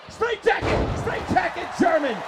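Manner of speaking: high-pitched exclamation easing down; excited move call. Real, not acted. Commentator calling a surprise suplex, excited. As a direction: cry out the move as it happens.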